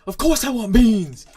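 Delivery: full-volume, high-pitched indignant scream. Retold or imitated. Customer answering the server about beans, imitated outraged scream. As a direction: scream it, stretching the words out. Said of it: "screamed it so loud".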